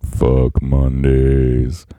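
very low, dragged-out cartoon cat voice; Garfield. Host trying out his Garfield on hating Mondays, deep and drawn out. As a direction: stretch every word out deep and slow. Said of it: "how about mine? Let me, let me try it out".

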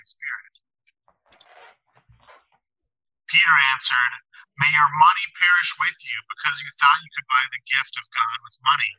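tinny, thin sound like over a phone; speaking through a bad phone line. Real, not acted. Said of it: "You sound like you're on a phone".

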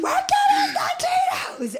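high laughing yell; mock shout. Retold yelling in liquor store.

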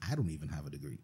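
ordinary talk, nothing marked

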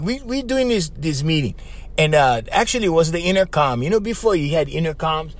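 usual speaking voice

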